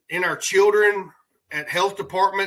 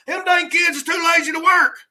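mock grumpy complainer; 10 semitones higher than usual. Mocking the anti-immigrant side.

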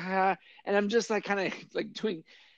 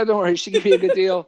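louder, higher, brushing off thanks; retold loud reassurance; 7 semitones higher, 8 dB louder, faster than usual. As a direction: reassure them loudly, pitched up. Repeats the reassurance to buddy.

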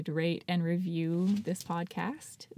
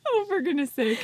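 laughing high; 9 semitones higher than usual. Real, not acted.

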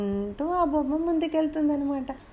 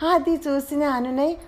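plain reading voice

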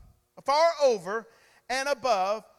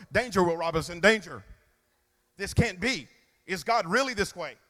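lower, mock-panicked warning; mock robot alarm voice; 5 semitones lower than usual. Preacher mocks listeners hearing life spoken, robot warning. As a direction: sound the alarm like a sci-fi robot. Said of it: "you go".

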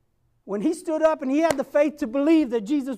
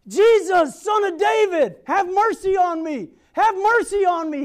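loud desperate cry for mercy; yelling; 5 semitones higher, 6 dB louder than usual. Retold or imitated. Blind Bartimaeus shouting to Jesus over the crowd, desperate cry. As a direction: call out to Jesus at the top of your voice. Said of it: "So he starts yelling".

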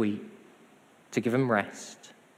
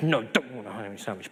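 lower, sulky mock refusal; mock grumpy; 4 semitones lower, 3 dB quieter than usual. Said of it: "We get all grumpy".

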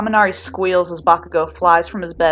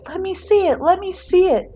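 high-pitched mock screech; high screechy mom, lightly mocked; 13 semitones higher than usual. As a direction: imitate a panicked mother. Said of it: "his mom starts screeching".

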